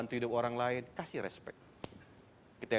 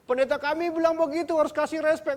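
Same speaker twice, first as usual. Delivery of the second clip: mocking quote; 11 dB louder than usual. Mocking congregants quoting their pastor.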